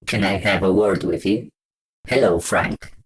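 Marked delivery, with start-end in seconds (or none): none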